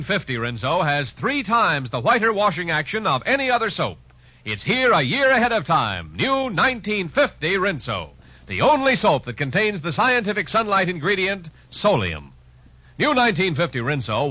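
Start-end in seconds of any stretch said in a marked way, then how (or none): none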